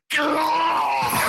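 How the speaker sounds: high-pitched mock shout; mock yell. Joking how he should have scared the kid, mock yell. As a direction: holler it as a pretend scare. Suggests yelling at the watching kid.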